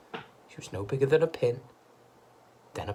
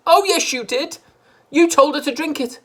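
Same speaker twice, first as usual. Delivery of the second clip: high, loud accusation; accusing wife; 12 dB louder than usual. A narrator's reading. Missus Cranky blaming her husband, high and loud. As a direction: pin the blame on him.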